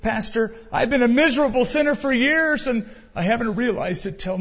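high, loud mock wailing confession; a church member's mock wailing confession to the pastor; imitating a wailing man. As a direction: ham up a weeping sinner crying out. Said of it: "he started weeping, crying, wailing".

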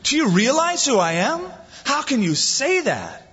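high, incredulous mock exasperation; exasperated Jesus. Preacher voicing an imagined hurt Jesus, incredulous. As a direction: play it as offended disbelief. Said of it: "Do you think his reaction was".

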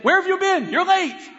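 high, loud scolding shout; indignant yell. Retold or imitated. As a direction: imitate an angry man berating a latecomer. Angry stranger's complaint, story punchline.

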